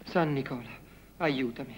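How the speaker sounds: ordinary delivery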